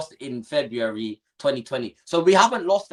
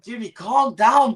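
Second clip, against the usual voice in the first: retold plea; 8 semitones higher, 5 dB louder, slower than usual. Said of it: "I'm like".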